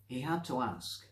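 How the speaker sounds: plain reading voice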